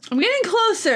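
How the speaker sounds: pleased to hear progress; excited. Real, not acted. Practicing harmonica on camera, excited. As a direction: pitch jumps high on "getting", bright with hope.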